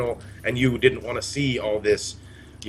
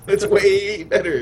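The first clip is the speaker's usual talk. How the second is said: laughing echo; 9 semitones higher, 5 dB louder, slower than usual. Retold or imitated. Echoing the joke, laughing.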